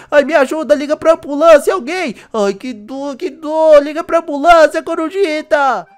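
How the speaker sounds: in pain. Acted. Pinned under the bike.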